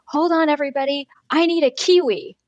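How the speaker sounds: imitating an insistent little girl; child's sudden realization. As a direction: announce it like a kid with a big idea. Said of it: "she had this realization. She said".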